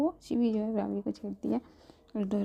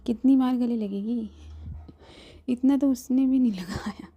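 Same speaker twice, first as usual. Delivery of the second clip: mock complaint; 4 semitones higher, 7 dB louder than usual. Mock complaint about hugs.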